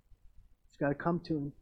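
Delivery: ordinary talk, nothing marked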